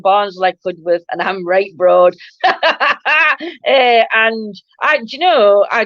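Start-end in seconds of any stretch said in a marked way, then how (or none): 2.4-3.6 high peal of laughter; real amused cackle; laughing. Real, not acted. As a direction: laugh at yourself, high and free. Laughing at her broad accent.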